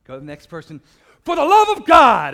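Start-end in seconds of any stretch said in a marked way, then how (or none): 1.2-2.3 very loud and high, stretched slowly; slow full-throated shout. Retold or imitated. Scott moving down the line to the next person, a slow roaring shout.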